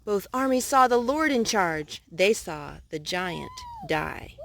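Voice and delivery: plain reading voice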